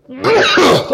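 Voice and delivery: sharp coughing fit; fizzy coughing fit. Real, not acted. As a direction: cough as bubbles catch your nose. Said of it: "Doctor Pepper bubbles get up my nose".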